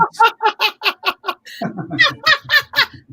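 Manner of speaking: high evil witch cackle; witchy cackle. Acted. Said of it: "I'm feeling weird and evil".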